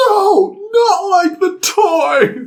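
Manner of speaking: very high, drawn-out protest; mock exasperated outburst. Passenger [name] correcting the driver about his name, exasperated. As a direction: wail it high in mock outrage.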